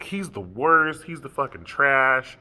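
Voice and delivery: mock angry rant, loud, words punched; mother ripping into son. Mother tearing into her son at family therapy, angry rant. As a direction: rip into him, drawing out the insults. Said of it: "just ripped into my ass".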